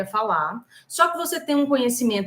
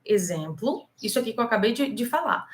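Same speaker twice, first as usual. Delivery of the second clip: normal talking voice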